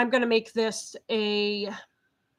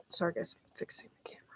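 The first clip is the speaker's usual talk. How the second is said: whisper; 7 semitones lower, 14 dB quieter, much faster than usual. Real, not acted. Aside while fixing the camera.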